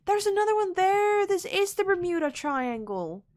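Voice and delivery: loud, high burst of excitement; excited shout, easing off slightly; excited outcry. Real, not acted. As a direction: cry out as you spot it. Spots another wreck, calls out.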